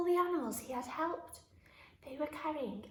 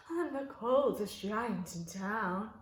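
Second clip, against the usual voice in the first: slow, lower, sorrowful finish; sad giant; 4 semitones lower, slower than usual. A narrator's reading. George shivering and cold, slow and sad. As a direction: let the rhyme droop sadly. George sadly ends song.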